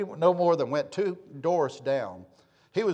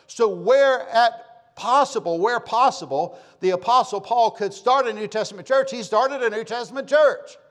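raised, higher preacher's voice; impassioned preaching; 6 semitones higher, 4 dB louder than usual. Real, not acted. Preacher urging church planting on the congregation, impassioned. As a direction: drive each phrase home loudly.

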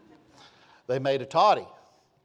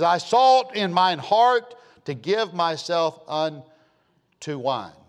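scripture reading; 5 dB louder, much slower than usual. Shown as a demonstration.

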